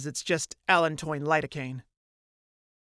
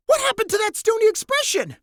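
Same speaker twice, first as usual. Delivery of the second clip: high, louder startled cry; startled exclaim; 6 dB louder than usual. Acted. Colline surprised by Mirthafan's outburst, high startled cry.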